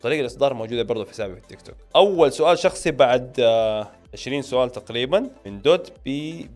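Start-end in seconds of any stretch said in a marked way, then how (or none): none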